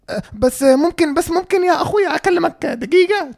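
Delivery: hesitant plea rising into a high voice; mock freaked-out woman. Woman asking for a minute to talk, high mock pleading. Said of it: "And then she freaked out".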